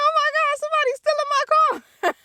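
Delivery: high, frantic oh my God; panicked cry. Retold or imitated. Watching a stranger drive off in his car, high panicked cry. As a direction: shriek it like the car is vanishing. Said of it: "I'm like".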